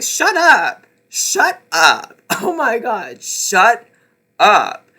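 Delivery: loud, fed-up shut up; exasperated shut up. Real, not acted. Reviewer yelling at a silly character, loud exasperation. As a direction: yell it at the character in disbelief. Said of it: "exasperated at the character".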